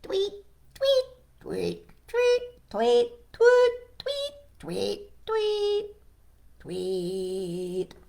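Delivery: piping storybook bird calls; birds tweeting. Acted. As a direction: imitate a chorus of birds. Voices birds and pig tweeting.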